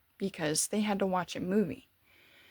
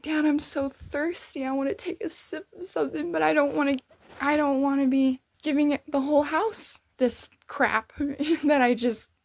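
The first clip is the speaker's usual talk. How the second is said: higher-pitched mock-anxious pleading; retold fearful pleading; 4 semitones higher than usual. Thirsty, telling dad the worry, retold anxious pleading. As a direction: plead in an imitated worried whine, pitched up. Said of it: "trembling in fear".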